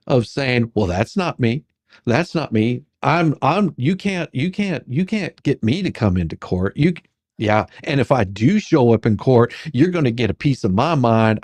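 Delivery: ordinary talk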